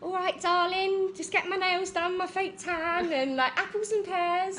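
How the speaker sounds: loud, high Cockney chatter; Essex Cockney accent. Shown as a demonstration. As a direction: put on a broad Essex Cockney voice, pitched high. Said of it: "you know, the Cockney English".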